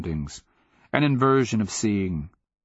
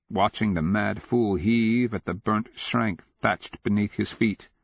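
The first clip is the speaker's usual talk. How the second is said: usual speaking voice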